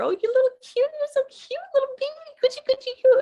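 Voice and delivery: put-on very high, sing-song cooing over a baby; high baby talk. Shown as a demonstration. Speaker shows how one acknowledges how cute a baby is, high baby talk. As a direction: coo in a way-up high register, as to an infant. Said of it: "Your voice would go up into the higher register".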